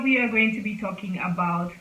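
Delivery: usual speaking voice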